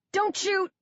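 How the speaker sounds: high frightened cry; panicked cry. A narrator's reading. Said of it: "Coley cries".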